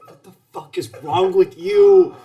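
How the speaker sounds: loud, incredulous yelling at a fool; exasperated shout. Acted. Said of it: "just staring at you like".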